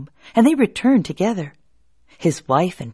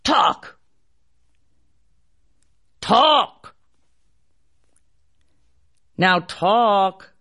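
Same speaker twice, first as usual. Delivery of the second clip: barked command; much slower than usual. Acted. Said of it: "and barked".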